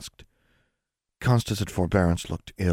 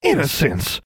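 higher, louder horrified cry of a name; horrified exclamation; 8 semitones higher, 4 dB louder, slower than usual. A narrator's reading. Sisters shocked to see their little sister caught, horrified cry. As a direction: gasp out the name in horror. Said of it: "in identical horrified tones".

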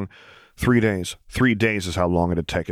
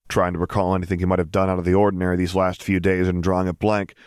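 ordinary delivery, nothing marked

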